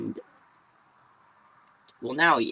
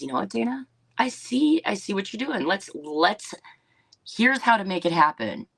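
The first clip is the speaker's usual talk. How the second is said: higher, upbeat imitation of an encouraging partner; Courtney's encouraging voice; 6 semitones higher than usual. Dana imitating business partner Courtney cheering her ideas on, higher and encouraging. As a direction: lift your pitch and sound like the friend who says yes, let's do it.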